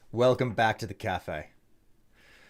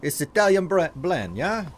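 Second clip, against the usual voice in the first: hammed-up Italian accent, higher and louder; Italian barista accent; 6 semitones higher, 3 dB louder than usual. Streamer putting on an Italian barista accent for chat. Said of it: "Oh, Italian barista".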